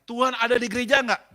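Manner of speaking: high, booming mock interrogation; loud challenge. Husband asking his second trap question, loud and challenging. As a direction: push the question at him forcefully.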